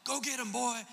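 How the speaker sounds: high imitating call to a dog; mock yell to dog. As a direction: holler it like a hunter sending his dog. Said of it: "I hear him yell to his dog".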